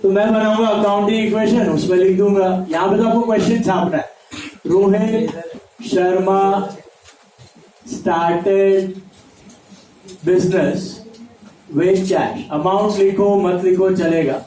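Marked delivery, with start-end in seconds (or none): 4.7-12.4 slow, with long gaps between words; word-by-word dictation. Real, not acted. Teacher dictating a question for students to write, word by word. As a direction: dictate it one word at a time, unhurried.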